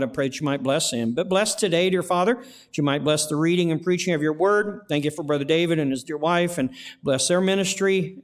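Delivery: usual speaking voice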